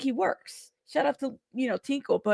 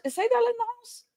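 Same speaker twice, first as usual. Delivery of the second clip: high squeaky voice; 11 semitones higher, 4 dB quieter, much faster than usual. Pretend. Playful call-out in put-on voice.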